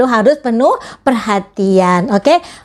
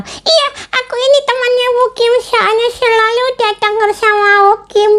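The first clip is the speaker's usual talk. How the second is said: put-on much higher childlike puppet voice; puppet friend; 13 semitones higher than usual. Teacher voicing her puppet for the children, high puppet voice. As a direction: play the cheeky puppet sidekick, pitched way up.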